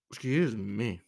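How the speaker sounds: words pushed out through a burp. Real, not acted. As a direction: say it mid-burp. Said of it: "All right, burp there".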